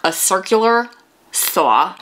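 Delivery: a circular saw said in a put-on New York accent. Shown as a demonstration. Joking about her New York roots, in a New York accent. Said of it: "especially if you're from New York".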